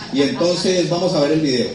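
announcing loudly. Real, not acted. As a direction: announce the clip to the whole audience. Anuncia el video al público. (anunciando el video en voz alta al público)

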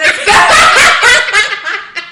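loud, high laughing fit; burst of laughter. Real, not acted. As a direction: crack up helplessly. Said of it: "Me dio risa".

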